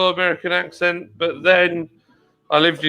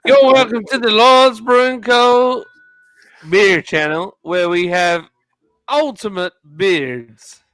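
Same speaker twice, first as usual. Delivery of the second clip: badly faked Texan announcer; English-sounding Texan; 5 semitones higher, 5 dB louder than usual. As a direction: welcome viewers in a hammed-up Texan accent. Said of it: "That's the most English Texan".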